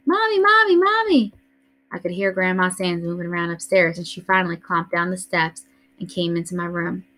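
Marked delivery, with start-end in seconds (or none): 0.1-1.3 high shrieking calls for mother; screaming. A narrator's reading. Scared little girl screams for her mother, high and loud. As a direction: scream for Mommy up the stairs. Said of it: "ran up the stairs screaming".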